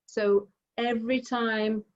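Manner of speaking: ordinary delivery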